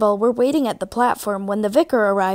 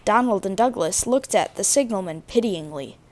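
plain reading voice

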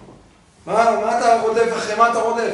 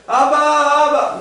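put-on whiny child calling; 8 semitones higher, 6 dB louder, much slower than usual.

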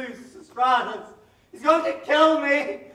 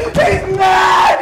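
panicked cry; 7 semitones higher, 11 dB louder than usual. Acted.

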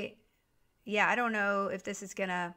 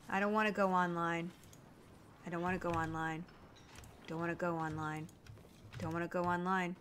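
fading mumble; 6 semitones lower, 8 dB quieter than usual. Real, not acted. To herself as the game loads, a lower, softer, fading chant. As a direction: chant that sinks lower and softer each time.

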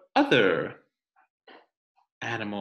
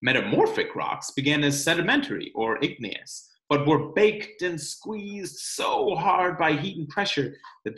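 put-on reading to children; 3 semitones higher than usual. Teacher reading the rock book to her class, storytime narration. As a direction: read it aloud for the class.

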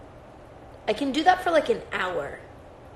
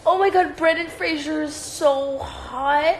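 higher and louder, gushing and drawn out; swooning excitement; 6 semitones higher, 4 dB louder than usual. Real, not acted. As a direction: swoon over the crush, stretching the words. Gushing over celebrity crush.

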